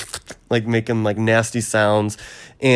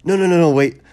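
higher, louder string of protests; playful retold alarm; mock panic; 7 semitones higher, 5 dB louder, faster than usual. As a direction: imitate someone frantically calling a halt. Acted stalling before the cut.

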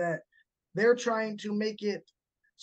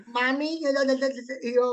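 put-on little girl lisp; 5 semitones higher, 3 dB louder, slower than usual. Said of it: "her lisp".

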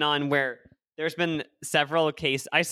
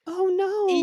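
put-on old-film starlet squeal, very high; high-pitched girl voice; 3 dB louder than usual. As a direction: mimic old-time girls with a high-pitched voice. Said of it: "had such a high-pitched voice".

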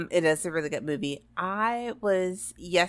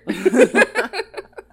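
high, loud burst of laughter; 13 semitones higher, 10 dB louder than usual. Real, not acted. Podcast host cracking up at co-host's joke, loud laugh. Laughing after joking about pain.

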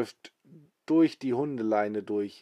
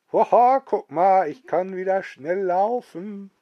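higher, louder gleeful exclaiming; delighted granny; 8 semitones higher, 7 dB louder than usual. Acted. Old grandma showing off that she can run again, gleeful. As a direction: crow with joy at your new speed.

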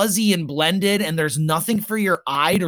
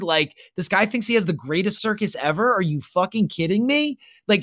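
ordinary talk, nothing marked

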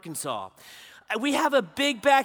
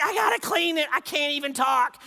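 excited squeal; 4 semitones higher than usual. Retold or imitated. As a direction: blurt it out, almost too thrilled to speak. Daughter too excited to talk.

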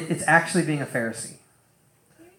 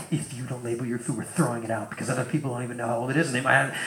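quick, quieter rule-reading tone, a touch fussy; mock strict rule; 6 semitones lower, 9 dB quieter, faster than usual. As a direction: rattle off the rule like a petty notice. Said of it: "Not like".

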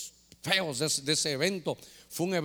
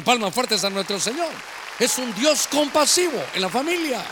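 raised, driving voice rousing the congregation; impassioned preaching; 3 semitones higher, 5 dB louder than usual. Real, not acted. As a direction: preach the promises with mounting force. Preacher builds to applause. (prédica exaltada, voz alzada)

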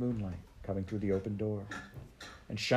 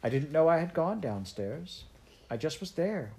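higher-pitched, lightly acted little girl; a hint of a puzzled child explaining herself; lightly voiced bewildered child; 7 semitones higher than usual. Heidi telling the doctor about going downstairs, mildly bewildered.

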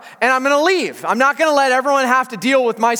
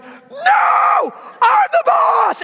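put-on high, drawn-out toddler shriek; imitated tantrum scream; 14 semitones higher, much slower than usual. Toddler challenges daddy, screamed high and slow. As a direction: scream defiance like a two-year-old. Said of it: "he starts screaming".

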